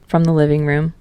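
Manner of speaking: ordinary delivery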